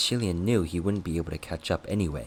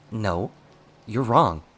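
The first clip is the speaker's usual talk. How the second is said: raised, urgent protest; crying out; 3 semitones higher, 6 dB louder, slower than usual. A narrator's reading. Master crying out in denial to his accusing servant. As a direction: protest loudly on the last words. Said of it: "Shi Lian cried".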